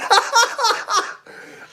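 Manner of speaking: real high-pitched burst of laughter. Real, not acted. Streamer cracks up at own Alberta joke, high laugh.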